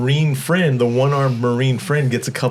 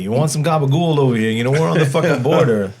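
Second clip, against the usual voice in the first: fast, loud mock Jersey gangster voice; lightly doing a Jersey mob accent; imitating a New Jersey mobster; 3 semitones higher, 3 dB louder, faster than usual. Podcast host mimics the actor's Jersey mobster talk, hammed-up Jersey accent. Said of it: "goes back to being fucking James Gandolfini".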